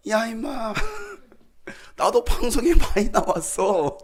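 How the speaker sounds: high, laughing, slow protest; laughing at the student who doubted him; laughing retort. Retold or imitated. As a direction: laugh through the comeback.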